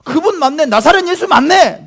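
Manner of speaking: excited, high, loud crowd member; loud, high-pitched excited calling; someone shouting out. Acted. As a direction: call out as if spotting someone famous. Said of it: "누가 소리친 거예요".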